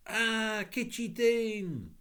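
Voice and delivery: high, slow alarmed French lady; alarmed cry. A narrator's reading. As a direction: cry out in a fright, French accent. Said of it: "cried the lady".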